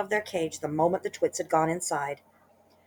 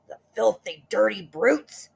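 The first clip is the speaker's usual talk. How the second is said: mild angry yell; 4 semitones higher, 4 dB louder than usual. Acted. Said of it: "yelled Mister Twit".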